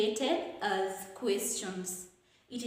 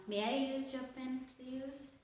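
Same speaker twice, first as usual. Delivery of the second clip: soft request, easing off on "please"; falling intonation; 2 semitones higher, 7 dB quieter than usual. Shown as a demonstration. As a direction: ask softly, letting "please" die away. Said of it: "It's supposed to fall".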